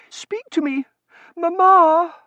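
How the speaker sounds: high-pitched anxious daughter; plead, rising to a loud cry; distraught pleading. Acted. Daughter pleading with fainted mother.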